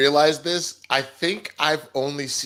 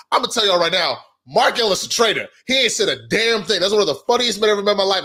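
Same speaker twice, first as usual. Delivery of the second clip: louder, higher accusing callout; confident callout; 6 semitones higher, 4 dB louder, faster than usual. Retold or imitated. Player accusing Mark of being a traitor at the table, loud. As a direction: call him out boldly to the room. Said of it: "I was like".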